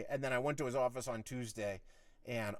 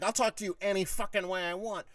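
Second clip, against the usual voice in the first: high, loud, heated retort; worked-up refusal to back off; retold defiant comeback; 9 semitones higher, 5 dB louder than usual. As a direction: shout back at the boss, re-enacting it. Retold shouting match, defiant answer.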